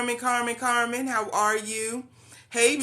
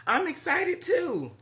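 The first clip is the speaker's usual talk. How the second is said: excited; 9 semitones higher than usual. Real, not acted. Greeting viewers on a live stream.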